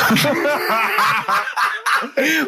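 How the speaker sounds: a burst of high giggling at his own story; amused high laugh. Real, not acted.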